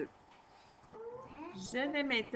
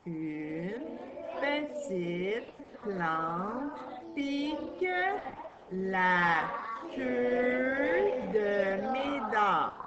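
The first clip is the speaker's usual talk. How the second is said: slow read-aloud; much slower than usual. Shown as a demonstration. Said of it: "on va aller lire".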